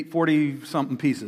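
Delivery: ordinary delivery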